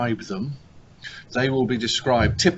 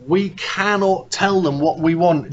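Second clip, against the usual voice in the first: mock commentator line; 4 semitones higher, 6 dB louder, slower than usual. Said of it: "people also saying".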